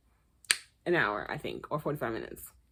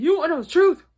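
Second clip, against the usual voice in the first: mock angry dad; 6 semitones higher, 4 dB louder than usual. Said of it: "Daddy John has said".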